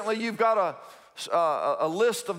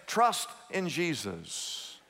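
soft reassurance; 3 semitones lower, 5 dB quieter, slower than usual. Acted. Pastor answers softly.

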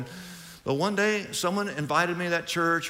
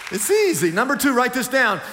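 emphatic preaching; 9 semitones higher, 5 dB louder than usual. Real, not acted. Preacher drives home key point.

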